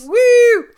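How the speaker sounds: loud, high whoop; mock cheering whoop. Co-host cheering the boombox gesture, mock whoop. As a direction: whoop like an excited crowd. Cheering after women love this.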